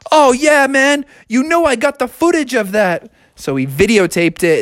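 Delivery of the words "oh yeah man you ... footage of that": put-on high, stoked kid; Froggy. Boy who filmed the stunt, stoked. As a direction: answer like an excited skater buddy. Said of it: "And Froggy said".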